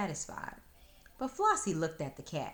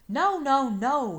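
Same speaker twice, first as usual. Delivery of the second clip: loud, higher sobbing noes; 9 semitones higher, 12 dB louder, slower than usual. Acted. Fox crying like a baby to Flossie, loud sobbing. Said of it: "he sobbed".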